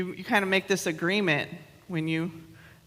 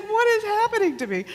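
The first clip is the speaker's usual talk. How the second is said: higher mock dismay; 12 semitones higher, faster than usual. Retold dismay.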